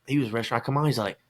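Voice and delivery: usual speaking voice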